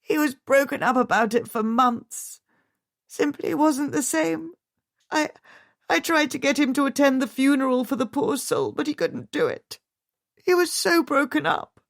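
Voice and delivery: distraught. A narrator's reading. Grieving widow's account.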